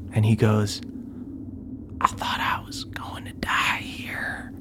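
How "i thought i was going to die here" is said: tearful whisper. Acted. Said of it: "he starts tearing up".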